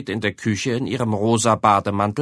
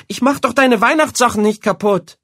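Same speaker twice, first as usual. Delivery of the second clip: much higher, louder indignant denial; 12 semitones higher, 6 dB louder than usual. A narrator's reading. Boy protesting to his mother, indignant. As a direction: protest his innocence, outraged.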